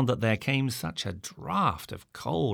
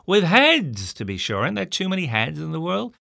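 high, loud indignant shout; loud angry retort; 8 semitones higher, 7 dB louder than usual. A narrator's reading. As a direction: throw the words back in outrage. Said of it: "shouted I".

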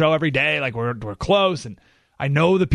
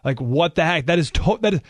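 ordinary talk, nothing marked

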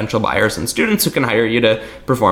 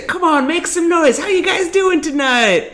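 hype host. Retold or imitated. Magician mocking a hype host's opener, pumped-up emcee voice. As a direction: work the crowd like a cheesy entertainer. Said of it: "And I'd say".

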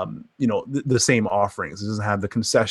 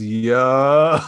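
laughing yo; 3 dB louder than usual. Retold or imitated. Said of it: "slide that just says".

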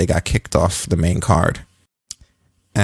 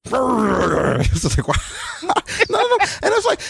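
laughing. Real, not acted. Host laughing at the wrestlers' voices, cracking up. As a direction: talk while you can't stop laughing.

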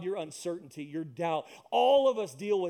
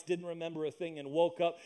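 normal talking voice

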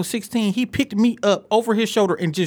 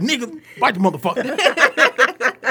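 mock cousin's order; 5 semitones higher, slower than usual. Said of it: "he was like".

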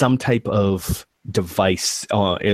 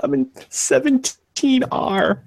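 slow, very high timid squeak; frightened answer. Acted. Scared worker tells the intruder his room, frightened. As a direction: give it up haltingly in a scared high voice.

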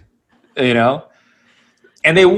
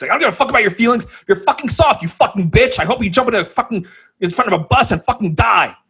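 higher, very fast put-on voice of a spiteful kid; bratty teen rant; 6 semitones higher, faster than usual. Teen ranting at the manny in a fast bratty voice. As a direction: rattle off the insults like a nasty teen. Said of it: "when you say".

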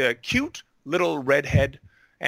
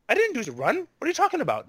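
much higher-pitched imitation of a player; imitated puzzled protest; 12 semitones higher, much faster than usual. Player tells the game master she never ran, high puzzled protest.

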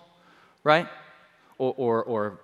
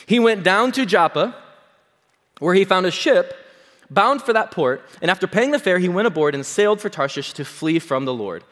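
louder, higher Bible reading voice; scripture reading; 5 semitones higher, 6 dB louder than usual. Acted. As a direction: proclaim the verse to the congregation. Reads scripture aloud.

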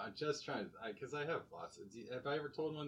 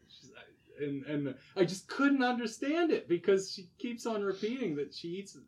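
usual speaking voice

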